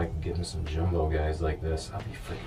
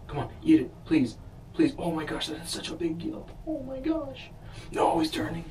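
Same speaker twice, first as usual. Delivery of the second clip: excited; 11 semitones higher than usual. Real, not acted. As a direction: beg the fish to bite, rising in pitch. Fish nibbling bait live.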